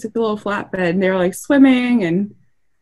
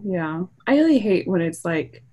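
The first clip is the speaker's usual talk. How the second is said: ordinary delivery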